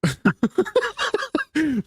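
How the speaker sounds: high, laughing mockery; real laughter breaking into the words. Real, not acted. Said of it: "laughing while mocking accent".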